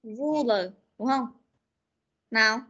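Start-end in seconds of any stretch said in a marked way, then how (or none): 0.0-0.7 Ruler with a clean English r; model pronunciation. Shown as a demonstration. Teacher models Ruler for the pupils. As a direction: show the class how Ruler sounds. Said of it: "Ta đọc".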